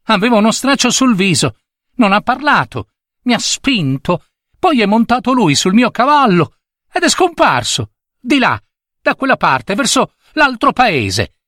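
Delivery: put-on high-pitched boy's voice; child regaining courage. A narrator's reading. Boy describes the robber to the servant, regaining courage. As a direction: recount it eagerly as a young boy. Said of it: "riprendendo coraggio".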